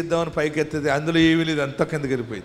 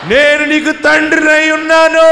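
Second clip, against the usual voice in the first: loud, much higher proclamation; booming voice of God; 11 semitones higher, 8 dB louder than usual. Acted. Preacher declaring God's word to the weeping listener, booming. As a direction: thunder it out as God.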